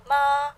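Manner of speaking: MA high and level; first tone. Shown as a demonstration. Teacher explaining tone marks, high level MA. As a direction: keep MA steady at the top. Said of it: "first tone with a straight line".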